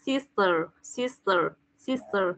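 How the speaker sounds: 2 "sister" said slowly, with a clear hissed s in the middle; 3 model "sister" and lean on the s sound; 1 careful "sister" model. Shown as a demonstration. 4 teacher modelling "sister" for a student, slowly with a clear s. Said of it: "con phải đọc là sis".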